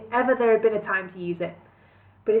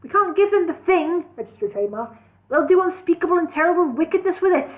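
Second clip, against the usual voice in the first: desperate plea; 8 semitones higher, 4 dB louder, faster than usual. A narrator's reading. Desperate hero voice.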